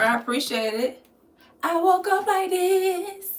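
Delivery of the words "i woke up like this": sassy diva line. Pretend. Reading viewer compliment, posing.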